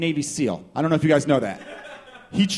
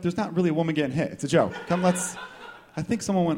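normal talking voice

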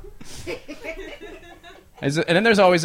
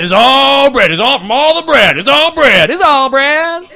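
bread-blaming rant; 2 semitones higher, 4 dB louder than usual. Acted. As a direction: escalate into a hammed-up protest. Customer blaming bread, building rant.

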